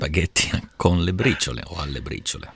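Italian-accented dish name, softly. Shown as a demonstration. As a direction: say it like an Italian, with a small laugh. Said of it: "Say it with your Italian accent".

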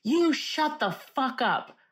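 high, slow, spaced-out scolding; retold outburst, each word drawn out; mock angry order. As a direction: imitate a man telling off noisy kids. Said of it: "super loud and goes".

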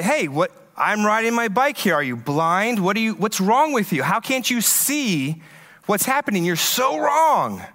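high-pitched indignant yelling at a driver; mock angry yell. Cyclist yelling at a truck driver, indignant mock shout. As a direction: play the outraged cyclist shouting. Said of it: "start screaming at the person".

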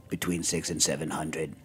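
low, quiet deadpan answer; deadpan monotone. Retold or imitated. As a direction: drop it low and flat. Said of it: "So Jack was like".

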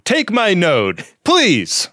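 slow, high punchline delivery like a borscht belt comic; old Catskills comic. Retold or imitated. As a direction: deliver it like an old vaudeville one-liner. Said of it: "He's an old Catskills comic".

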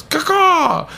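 high cawing bird call; high imitated crow squawk. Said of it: "this guy goes".

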